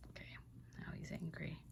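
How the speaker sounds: hushed whisper to the camera; soft whispered remark. Real, not acted. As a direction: whisper the news in secret. Whispered aside.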